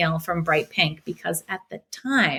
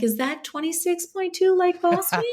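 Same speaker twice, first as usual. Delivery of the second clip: clueless friend; 12 semitones higher than usual. Retold or imitated. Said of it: "they're like".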